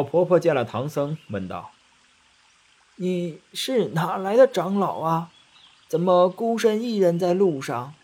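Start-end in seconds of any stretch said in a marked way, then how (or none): 3.0-7.9 put-on old woman. A narrator's reading. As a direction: ask kindly like an old village granny. Old woman asks, voiced. (老婆婆慢悠悠地和气发问)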